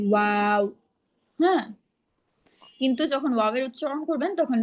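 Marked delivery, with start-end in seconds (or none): 0.1-0.6 ওয়াও with rounded lips, a bit louder; rounded light waw. Shown as a demonstration. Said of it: "দুই ঠোঁট গোল করে পাতলা আওয়াজ".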